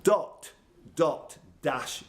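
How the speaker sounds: ordinary talk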